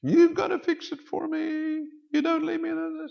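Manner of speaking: high, loud mock whine; self-pitying victim. Victim demands that somebody else fix it, acted high whine. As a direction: wail helplessly like an overgrown crybaby. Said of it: "takes on a victim mentality".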